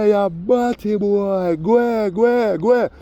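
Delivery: high, loud, slow jeering shout; mocking Rasta heckler, high and loud. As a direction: yell them off the stage. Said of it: "the Rasta man said".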